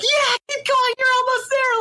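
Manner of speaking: high squeaky cheering, far above her own voice; cheering squeal. Retold or imitated. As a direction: squeal it like an excited fan. Cheering on friends mid-fight.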